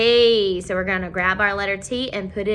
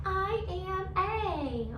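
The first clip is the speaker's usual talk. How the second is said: letter A voice; 8 semitones higher, 8 dB quieter, much slower than usual. Acted. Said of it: "he says".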